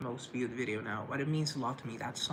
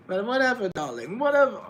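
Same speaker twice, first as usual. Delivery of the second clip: much higher, loud theatrical darling; Jennifer Coolidge mode; 8 semitones higher, 11 dB louder than usual. Retold or imitated. Vlogger slipping into a loud, high Coolidge diva voice. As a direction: toss it off like a diva. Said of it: "stuck in Jennifer Coolidge mode".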